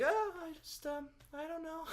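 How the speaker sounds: high, soft mock-bashful voice; sheepish retelling. Singer retelling his answer to directors, high and sheepish.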